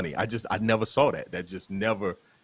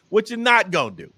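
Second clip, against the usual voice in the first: his own Southern way of saying "What you not gonna do?", a bit higher; Southern drawl; 8 semitones higher, 3 dB louder than usual. Shown as a demonstration. Comedian showing his own Southern version of the phrase. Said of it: "So I say".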